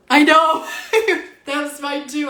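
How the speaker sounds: high, loud, laughing burst. Real, not acted. Laughing after a shared chill.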